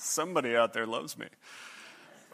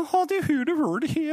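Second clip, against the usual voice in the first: mock Norwegian chatter; 13 semitones higher than usual. Girls chatting in Norwegian, imitated.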